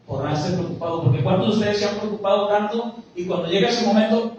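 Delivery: ordinary talk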